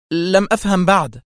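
high, tense complaint; nervous irritation. A narrator's reading. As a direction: say it edgily, out of patience. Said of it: "وهي تقول في عصبية". (نبرة عصبية متوترة)

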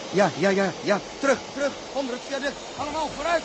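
high urgent calls to take cover; frantic shouted warning; shouting a warning. Acted. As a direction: yell them back under the viaduct. Warning people during rocket crash.

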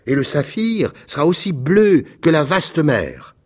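a small bird dreaming aloud of Egypt; little swallow. Acted. Swallow's farewell speech.

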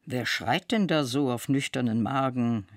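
ordinary delivery

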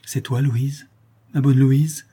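a touch of weeping joy; tearful. A narrator's reading. Father greets his daughter, mildly tearful. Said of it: "disait Morel en pleurant".

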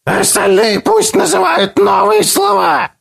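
high shouted creature chant; creature chorus. A narrator's reading. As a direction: chant it back as a mob. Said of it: "подхватил хор голосов".

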